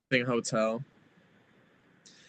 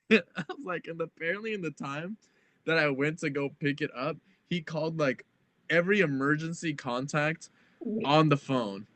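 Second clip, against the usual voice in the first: normal talking voice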